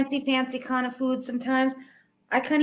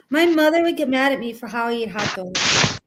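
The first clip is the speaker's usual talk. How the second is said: normal talking voice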